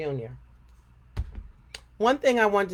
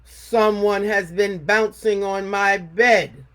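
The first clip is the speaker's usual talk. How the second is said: loud, slow, booming bear; Papa Bear voice; 2 semitones higher, 9 dB louder, slower than usual. A narrator's reading. Papa Bear complaining to his family, loud slow boom. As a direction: boom it out like a big indignant bear. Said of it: "said Papa Bear".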